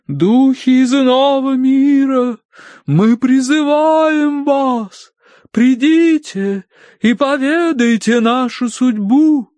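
high, loud, very drawn-out invocation; медиум призывает духов. A narrator's reading. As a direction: wail out a summons to the spirits. Medium summons spirits at séance. (громко, высоко и нараспев, заклиная духов)

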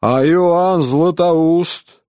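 high, slow declamatory call; mild narrated shout, words drawn out; shouted challenge. A narrator's reading. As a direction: call out the names like trump cards. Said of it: "закричал другой эрудит".

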